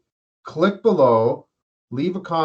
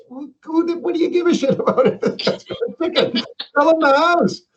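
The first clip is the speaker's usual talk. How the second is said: much higher pitched, laughing, disbelieving rant; incredulous high rant; 13 semitones higher, 3 dB louder than usual. Retold or imitated. Mocking the realtor who doubted the chicken, high incredulous rant. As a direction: squeal in mock outrage at the realtor.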